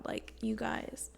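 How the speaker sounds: slow hushed whisper to the audience. Real, not acted. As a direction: lean in and whisper it slowly. Hushed aside to viewers.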